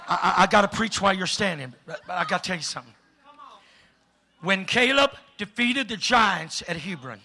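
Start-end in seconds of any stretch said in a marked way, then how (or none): 0.1-2.9 fast, soft, laughing aside; rushed aside. Real, not acted. Preacher hurrying to tell the standing crowd, fast and laughing. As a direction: hurry through it.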